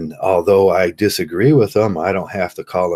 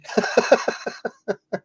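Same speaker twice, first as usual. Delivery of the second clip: high giggle; 4 dB quieter than usual. Real, not acted. Laughing after mock neighbor taunt.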